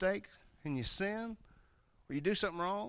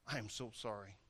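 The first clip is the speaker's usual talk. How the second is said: much softer and lower, contrite; sheepish apology; 9 semitones lower, 15 dB quieter than usual. Acted. Person apologizing after snapping, soft and sheepish. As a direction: mumble a small sorry.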